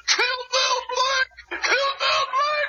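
high, frantic mock mob chant; imitated mob yell. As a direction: yell it like an angry crowd chasing you. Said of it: "all of them yelling".

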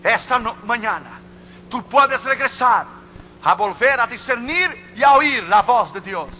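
high, emphatic pulpit delivery; forceful preaching. Real, not acted. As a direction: press the appeal on the congregation.

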